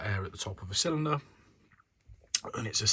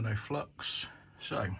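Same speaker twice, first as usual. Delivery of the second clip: normal talking voice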